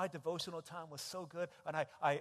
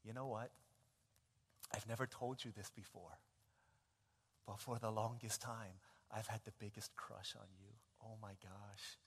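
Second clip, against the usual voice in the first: soft, lower, shy confession; 5 semitones lower, 6 dB quieter than usual. Acted. Girl confessing her crush to the boy, soft and shy. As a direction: murmur it like a secret crush. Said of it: "she says".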